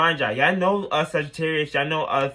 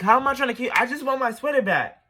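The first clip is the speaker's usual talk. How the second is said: incredulous; 9 semitones higher, faster than usual. Retold or imitated. Accused of trying to kill him.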